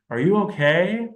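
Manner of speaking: put-on high, lifted child's question; child's echo. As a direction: voice a child asking it.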